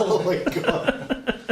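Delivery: exasperated laugh; laughing exasperation. Real, not acted. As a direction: groan it out through laughter. Exasperated at party antics.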